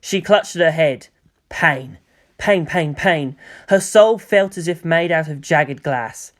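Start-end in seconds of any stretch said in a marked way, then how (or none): none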